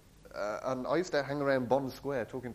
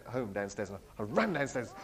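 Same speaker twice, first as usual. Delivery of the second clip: normal talking voice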